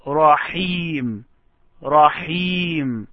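رحيم with breathy h and long "ee"; careful isolated رحيم; pronunciation model. Shown as a demonstration. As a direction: draw out the end of رحيم, falling. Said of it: "just pronounce this word".

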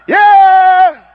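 high, loud whoop; hammed-up crowd yell; mock crowd cheer.

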